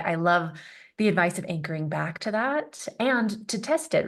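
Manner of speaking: ordinary delivery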